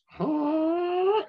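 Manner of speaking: very high held mock "huh"; high mock call. Describing a call people never make: very high drawn-out "huh". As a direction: hold a squeaky high "huh". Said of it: "they would never say".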